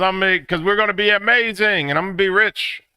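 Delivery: very high, loud hopeful boast; mock excitement. Artist boasting he will be rich, excited. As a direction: imitate a wildly hopeful artist. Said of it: "everybody's excited".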